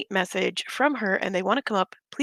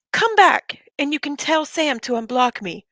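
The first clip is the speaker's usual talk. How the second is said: dramatic reading; 6 semitones higher than usual. Shown as a demonstration. Reading her rival's message to viewers, dramatically. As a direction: read the message out like a melodrama. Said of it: "I'm going to be reading it dramatically".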